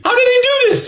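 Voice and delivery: very high, loud comic squeal; high-pitched baffled yell; incredulous outburst. Acted. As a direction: shriek it in mock disbelief. Baffled outburst at friend's nerve.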